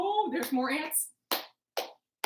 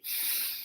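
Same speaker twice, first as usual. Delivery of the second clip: breathing out; 13 dB quieter than usual. Shown as a demonstration. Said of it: "two breaths in through our nose, out through our mouth".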